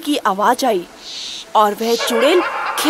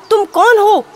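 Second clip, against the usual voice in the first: high, loud demanding yell; husband shouting at the invisible witch; angry shout; 7 semitones higher, 5 dB louder than usual. Acted. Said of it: "Ramu भी चिल्लाने लगा".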